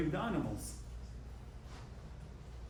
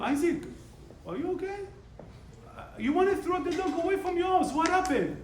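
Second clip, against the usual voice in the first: higher, a touch louder, teasing disbelief; amazed question; 14 semitones higher, 3 dB louder than usual. Retold or imitated. Rabbi asking friend Isaac about the dog, amazed. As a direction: ask like you can't believe the change.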